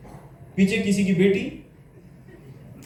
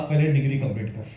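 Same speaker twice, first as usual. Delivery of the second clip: lecturer's advice; 10 semitones lower, faster than usual. Retold or imitated. Retold lecturer repeating advice.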